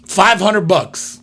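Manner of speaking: drawn-out slow price; slow emphatic offer. Retold or imitated. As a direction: spell the price out slowly. Haggling on phone.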